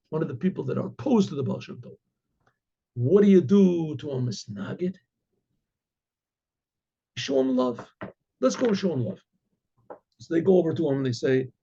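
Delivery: usual speaking voice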